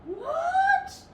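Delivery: very high squeaky outcry; a startled squeal; shocked what. Acted. Animals gasp at losing their television show.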